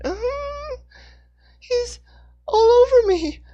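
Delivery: very high, rising to a loud panicked squeal; raving panic. Acted. Hannah, covered in viscera, raves to Dixon in high panic. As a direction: hysterical and disgusted. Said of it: "she raved".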